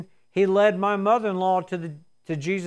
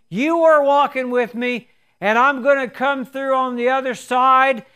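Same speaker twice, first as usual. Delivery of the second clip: fervent prayer; 3 semitones higher, 4 dB louder than usual. Acted. Preacher voicing a prayer in trial, fervent. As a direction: pray with steady conviction. Praying aloud in the sermon.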